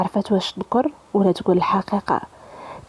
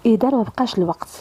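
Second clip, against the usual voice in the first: ordinary delivery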